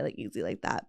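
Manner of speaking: ordinary delivery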